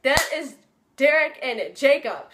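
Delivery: loud, high burst of fan excitement; re-enacted starstruck outburst; excited realization. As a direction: blurt it out on spotting your idols. Retold spotting of idols in car.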